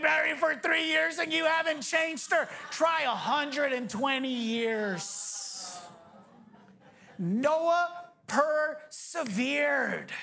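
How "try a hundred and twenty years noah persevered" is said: slowly, each word weighed; slow, deliberate payoff; slow emphasis. Acted. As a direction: drag it out for weight.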